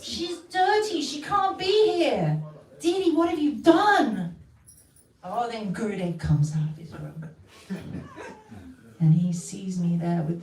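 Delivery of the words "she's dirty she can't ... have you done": higher, raised outraged cries; hammed-up outraged yell; mock scream. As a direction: temple men shout at Didi, mock outrage.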